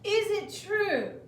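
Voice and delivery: high, slow flattering question; coaxing princess. A narrator's reading. Princess coaxing the dragon, high and slow. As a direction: draw the dragon in. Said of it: "said Elizabeth".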